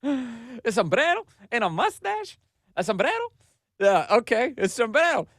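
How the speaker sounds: high mock-horrified sombrero squeal; mock outrage. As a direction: ham up her shock about the sombrero. Mocking the outraged congresswoman.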